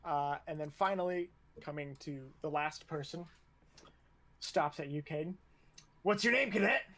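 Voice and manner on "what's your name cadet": higher, loud parade-ground demand; barking instructor. Acted. Instructor reaches the last cadet, barked question. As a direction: bark it at the last recruit.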